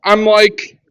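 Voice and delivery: ordinary talk, nothing marked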